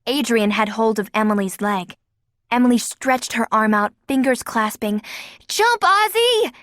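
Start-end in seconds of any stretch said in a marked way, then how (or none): 5.5-6.5 high, slow urgent yell. A narrator's reading. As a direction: urge him to leap. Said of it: "she yelled".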